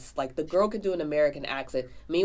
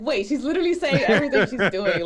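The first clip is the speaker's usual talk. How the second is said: louder, higher, laughing through the words; 7 semitones higher, 8 dB louder than usual. Retold or imitated. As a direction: burst out mid-laugh at the realization. Retelling realizing [name] narrates, laughing.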